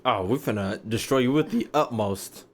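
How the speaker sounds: ordinary talk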